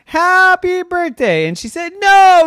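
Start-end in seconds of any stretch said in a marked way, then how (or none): 0.1-1.5 high, slow happy birthday; sing-song banner. A narrator's reading. Banner read out. 2.0-2.5 high, louder refusal; loud child no. A narrator's reading. Girl refuses, tears banner.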